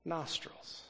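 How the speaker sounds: nostrils dropped to a soft, breathy near-whisper; hushed emphasis on the odd word. Acted. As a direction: drop nostrils to a hush for effect.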